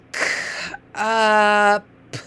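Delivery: K, uh, p drawn out, uh held long; sliding the sounds. Shown as a demonstration. Teacher showing a reader how to slide, sounds stretched. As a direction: stretch each sound of K, uh, p toward the next. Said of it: "slide the sounds".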